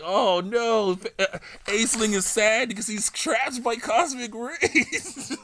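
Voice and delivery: high-pitched mock "oh no" breaking into laughter; laughing mock dismay. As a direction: gasp it out, giggling at the silly scene. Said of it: "I'm like".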